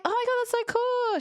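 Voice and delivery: very high, excited squeal of delight; gushing excitement. Retold or imitated. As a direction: gush like meeting someone cool. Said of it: "I'm like".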